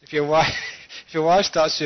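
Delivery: laughing. Real, not acted. Joke about wives, audience laughs.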